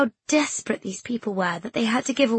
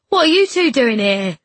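loud, raised-voice questioning lad; boisterous boy; 4 semitones higher, 9 dB louder than usual. Acted. Boy trotting over to two friends, boisterous. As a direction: call out to them cheerfully loud.

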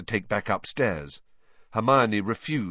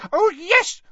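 high loud elf voice; eager house-elf; emphatic elf; 9 dB louder than usual. A narrator's reading. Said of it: "nodding her head furiously".

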